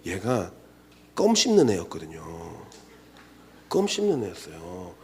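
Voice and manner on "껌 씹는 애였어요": ordinary talk, nothing marked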